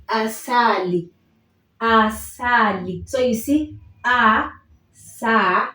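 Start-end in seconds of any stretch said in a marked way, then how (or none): 0.1-3.1 asali said slowly, a-sa-li, every a open; Swahili asali. Shown as a demonstration. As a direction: spell out asali slowly for repeating. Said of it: "The first example I have is".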